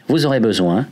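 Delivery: ordinary talk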